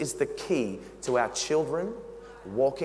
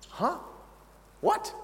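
mock disbelief; 11 semitones higher, 5 dB quieter than usual. Mock disbelief at Jesus' words.

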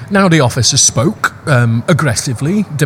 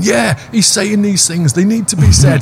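mock right-wing bloke; 7 semitones higher than usual. Host mocking society's bigots, jeering high and slow. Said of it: "coming out going".

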